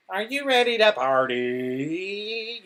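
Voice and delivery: slow, high vocoder robot. Retold or imitated. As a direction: announce it through a vocoder. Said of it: "so I could sound like a fucking robot".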